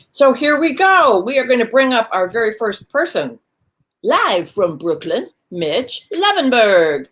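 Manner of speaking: big, high, stretched-out announcer call; showy MC build, drawing out the name; announcer introduction. Real, not acted. Host brings on first act.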